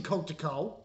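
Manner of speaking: ordinary talk, nothing marked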